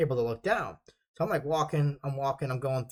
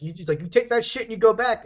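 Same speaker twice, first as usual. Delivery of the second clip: raised, higher voice giving an order; imitated sergeant's yelling; 7 semitones higher, 5 dB louder than usual. Drill sergeant sending a recruit back, mock yell. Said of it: "I just got yelled at by a drill sergeant".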